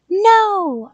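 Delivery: high, very loud refusal; shouted back. A narrator's reading. Child pounding the door, shouted back. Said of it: "I shouted back".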